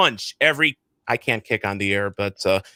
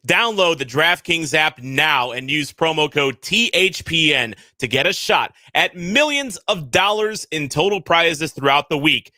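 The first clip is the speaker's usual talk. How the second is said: upbeat sponsor read; smooth podcast ad voice; 4 semitones higher than usual. Acted. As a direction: sell it like a radio spot. Host reads promo code.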